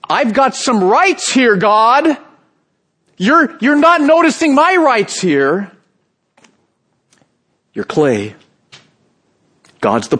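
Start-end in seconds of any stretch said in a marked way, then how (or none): 0.1-5.7 high, slow, indignant whine; preacher mocks a believer demanding rights from God; presumptuous complaint. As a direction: act entitled and wronged, pitched up. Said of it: "the presumption like".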